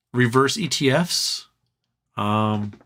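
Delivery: usual speaking voice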